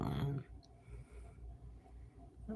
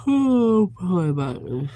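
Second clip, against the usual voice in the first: yawning; 9 semitones higher, 11 dB louder than usual. Real, not acted. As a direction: yawn stretching the words. Yawning while saying going to bed.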